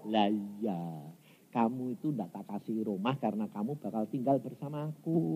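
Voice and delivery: soft, low voice of God; gentle consoling. Retold or imitated. Preacher voices God promising a shared home, gentle and low. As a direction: explain it tenderly, hushed and low. Voicing God's consoling reply.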